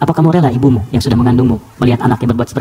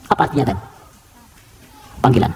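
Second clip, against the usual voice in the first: ordinary delivery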